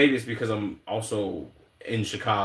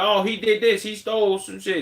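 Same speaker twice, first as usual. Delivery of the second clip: mock excited explanation; 9 semitones higher than usual. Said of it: "they'd be like".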